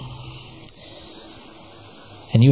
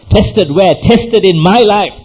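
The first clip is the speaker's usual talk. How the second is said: forceful preaching; 6 semitones higher, 6 dB louder, slower than usual. Real, not acted. Preacher drives point home.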